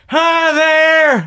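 loud, high-pitched drawn-out greeting; Bill Clinton. Acted. Comic showing off a poor celebrity impression, hammed-up Bill Clinton. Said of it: "you want Bill Clinton?".

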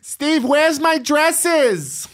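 high, slow, whining wife; whiny wife voice. Retold or imitated. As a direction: drag it out like a spoiled wife. Wife voiced calling to husband.

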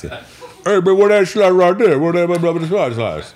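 a big friend's excited high-pitched imitation; imitating Tory. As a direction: pitch right up and boom it out like Tory. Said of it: "That's actually how Tory talks".

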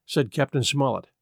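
ordinary delivery

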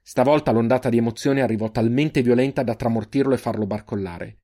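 ordinary delivery

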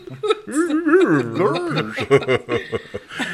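high giggling laugh; cartoon laugh. Real, not acted. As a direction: laugh high after the bit.

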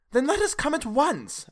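high, urgent cry; cried out. A narrator's reading. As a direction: burst out, high and pleading. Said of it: "I cried".

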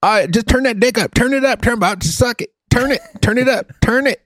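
very high, repetitive radio hype; hammed-up DJ impression. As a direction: parody a jock hyping a song. Repeats the mocked DJ voice.

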